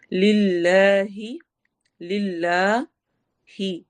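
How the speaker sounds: لِلَّهِ very slow with a light, thin lam, not heavy; slow demo of a thin lam in لِلَّهِ. Shown as a demonstration. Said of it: "موٹا نہیں کرنا، باریک پڑھا جائے گا".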